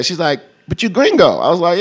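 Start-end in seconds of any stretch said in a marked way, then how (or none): 0.7-1.4 high, incredulous imitation; retold astonishment in a high voice; mock disbelief. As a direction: voice her puzzled objection, pitched well up. Club girl's disbelief, retold.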